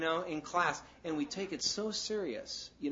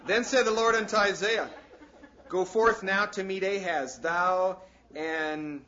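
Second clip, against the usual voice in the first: scripture reading; 4 semitones higher, 8 dB louder, slower than usual. Acted.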